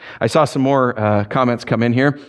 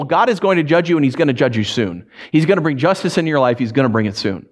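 ordinary talk, nothing marked

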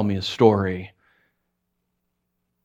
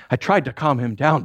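ordinary talk

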